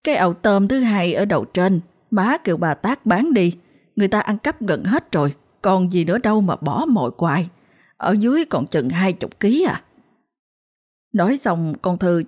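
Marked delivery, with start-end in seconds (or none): none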